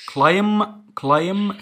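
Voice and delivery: "climb" said high and loud with no B sound; silent final B. Shown as a demonstration. Teacher showing pupils a silent letter, silent final B. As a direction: end "climb" on M, leaving the B silent. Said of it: "ما بنطقش البي".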